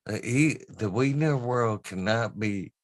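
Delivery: put-on high, loud Southern accent of a friend. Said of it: "real Southern accent".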